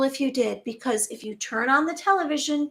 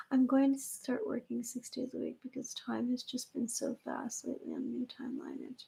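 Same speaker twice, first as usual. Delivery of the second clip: unvoiced, much softer whisper; whispered quote; 13 dB quieter than usual. Voicing an anxious viewer's thought in a whisper. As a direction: whisper the worried thought like a secret. Quoting an imagined person.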